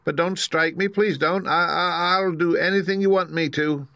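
high-pitched frightened begging; trembling plea. Acted. Little man begging not to be struck, trembling. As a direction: plead nervously, stumbling over the words. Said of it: "in a trembling voice".

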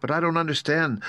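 a touch of confusion; bewildered. Acted. Dorothy questioning the wizard's tricks, bewildered. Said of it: "said Dorothy in bewilderment".

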